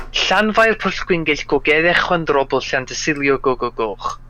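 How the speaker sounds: "Llanfair Pwllgwyngyll Gogeddech Chwandrobl Llantysilio Gogogoch" in fluent native Welsh; full Welsh pronunciation. Shown as a demonstration. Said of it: "with the full Welsh pronunciation".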